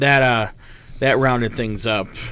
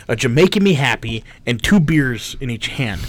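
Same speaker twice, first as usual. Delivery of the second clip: ordinary talk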